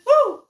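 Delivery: high loud laughing woo; joyful high whoop with a laugh; excited whoop. Real, not acted. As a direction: let out a delighted cheer. Cheering herself after the intro.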